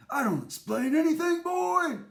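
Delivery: harsh father. Acted. Father refuses to explain to son.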